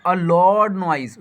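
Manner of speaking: a loud noise drawn out word by word, very slowly; slow, deliberate classroom reading; slow dictation. Shown as a demonstration. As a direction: spell it out slowly for learners. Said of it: "teacher reading slowly".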